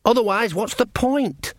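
high, whining, already defeated; wretched. Acted. Son pleads with his mum, high and wretched. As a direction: plead knowing you've lost. [name] continues wretched plea.

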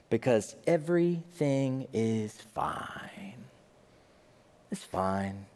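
slow, secretive whisper; whispered mock reassurance. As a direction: hush it like a secret. Bar patron in denial.

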